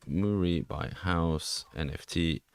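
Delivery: ordinary delivery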